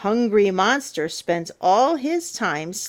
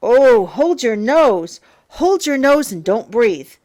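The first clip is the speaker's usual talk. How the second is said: loud warning; 3 semitones higher, 6 dB louder than usual. Acted. Stinky monsters warning.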